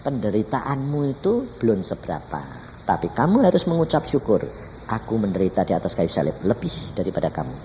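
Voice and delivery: calm, measured rebuke as God; lightly voiced God, softening and lowering by the end; composed divine answer. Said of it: "Tuhan ini ngomong".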